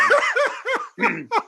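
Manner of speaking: a very high burst of laughter; real high-pitched giggle; high squealing laugh. Real, not acted. Cracking up.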